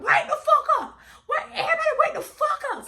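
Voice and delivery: shouting to the neighbors while laughing. Retold or imitated. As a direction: yell it, cracking up. Banging on neighbors' doors.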